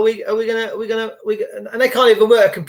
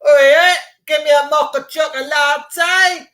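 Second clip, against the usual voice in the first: cocky shout; 5 semitones higher than usual. Retold or imitated. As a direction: shout the order like you own the place. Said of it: "you go into Costa".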